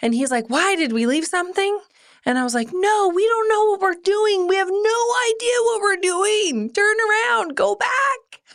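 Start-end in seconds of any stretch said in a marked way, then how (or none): 0.5-1.8 high, quick puzzled husband; imitating a bewildered husband; puzzled question. Voicing husband's puzzled question in anecdote. 2.7-8.4 high-pitched frantic new mom, retold; re-enacted panic, pitch way up; panicked yelling. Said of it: "I yell from the back".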